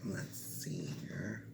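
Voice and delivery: soft, slow whispered mutter; whispered aside. Real, not acted. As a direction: whisper it to yourself. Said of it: "muttered aside".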